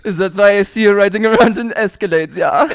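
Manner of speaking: German teasing with a questioning "ja"; mock German accent. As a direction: needle him in a comic German accent. Mock German teases his friend.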